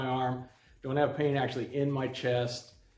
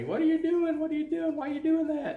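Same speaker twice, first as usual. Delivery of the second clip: imitating a wife yelling, much higher; faster than usual. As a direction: shout at him in alarm, pitched way up. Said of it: "she's yelling at me".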